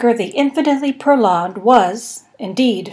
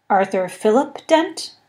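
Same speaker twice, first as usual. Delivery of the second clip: plain reading voice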